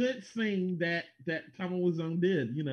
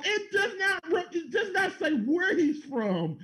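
laughing protest; 11 semitones higher than usual. Real, not acted. Laughing protest about Wiseau's origin.

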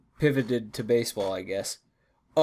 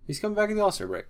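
fast and high; 7 semitones higher, much faster than usual. Real, not acted.

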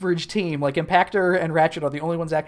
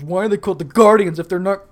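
mock tantrum; 2 semitones higher, 8 dB louder than usual. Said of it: "throws a little tantrum and goes".

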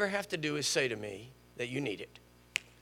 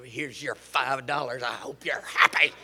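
mock grudging, low then strained and voiceless; grudging giver; 4 semitones lower than usual. Churchgoer putting five dollars in the offering, mock grudging. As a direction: imitate someone begrudgingly handing over money.

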